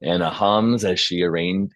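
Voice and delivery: normal talking voice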